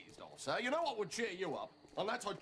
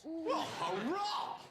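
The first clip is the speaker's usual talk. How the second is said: excited; 5 semitones higher, much slower than usual. Acted. Cheering the news.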